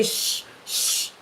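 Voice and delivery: soft, drawn-out shush; shushing under the breath; hushing. Retold or imitated. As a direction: imitate someone hushing a child silent. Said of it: "try to hold my mouth and say".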